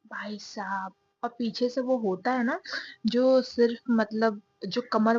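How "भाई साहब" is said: whispered aside. Real, not acted. Describing the dress to viewers, whispered. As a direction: breathe it as a secret.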